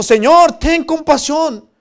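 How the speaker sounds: clamor alto y alargado pidiendo compasión; súplica actuada. Acted. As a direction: ruega a voces como siervo endeudado. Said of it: "le suplicó diciendo". (ruego dramatizado a viva voz)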